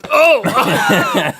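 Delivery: high, loud startled cry; surprised shout. Real, not acted. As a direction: yelp in sudden shock. Sudden reaction to a burp.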